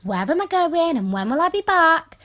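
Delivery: a hint of a prying copper father; nosy dad's question. Teen mimicking her dad quizzing her before she goes out. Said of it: "He always has time to be asking me".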